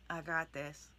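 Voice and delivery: low, soft, half-hearted; timid mumble. Shown as a demonstration. Coach showing the wrong way, a timid half-hearted mumble. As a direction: say it without believing it.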